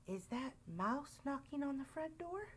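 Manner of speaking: hushed, rising frightened question; whispered. A narrator's reading. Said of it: "he whispered".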